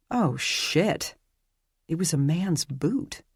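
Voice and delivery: plain reading voice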